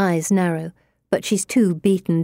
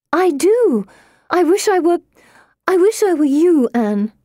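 much higher and louder, pleading; desperate insistence; 11 semitones higher, 5 dB louder than usual. Acted. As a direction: cry out the wish. Pippa insisting, highly emotional.

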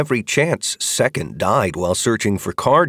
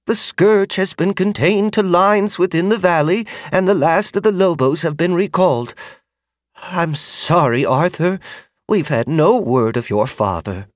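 higher, gentle apologetic woman's voice; kindly older woman; 9 semitones higher, 3 dB louder than usual. Acted. Magda's voice, sorry news.

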